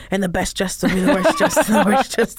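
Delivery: laughing imitation of her line; Kirstie Alley line. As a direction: quote the line half laughing. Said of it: "usually I'll just go".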